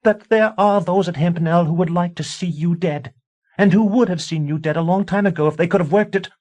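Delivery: high, loud screaming tirade; screaming wife. A narrator's reading. A wife screaming warnings at her husband. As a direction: shriek the warning at him.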